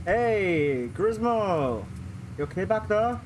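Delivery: calling to the back seat; dad calling back. Acted. Father calls to backseat daughter.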